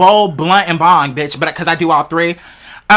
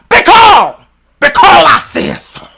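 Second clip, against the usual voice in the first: high, loud mock scolding because; hammed-up yelling; 8 semitones higher, 6 dB louder, slower than usual. Imagining someone talking like that, mock yelling. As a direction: belt out "because" as an exaggerated shout. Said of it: "I can see someone talking like that".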